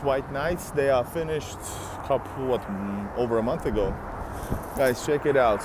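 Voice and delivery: usual speaking voice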